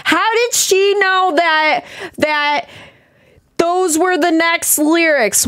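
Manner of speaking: high, slow, baffled mock viewer; puzzled viewer. Imagined viewer asking how she knew the lyrics, high and slow. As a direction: play a confused commenter, drawn out. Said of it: "somebody that's gonna be like".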